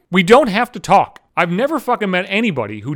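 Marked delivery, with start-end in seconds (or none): none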